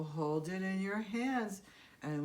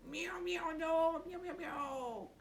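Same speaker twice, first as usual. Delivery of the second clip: much higher, cute meows; high playful kitty meows; kitten puppet meowing; 10 semitones higher than usual. Pretend. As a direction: meow back like a little puppet cat.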